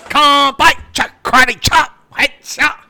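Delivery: sharp, loud martial arts shouts; kung fu master. Acted. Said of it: "Kung Fu master guy".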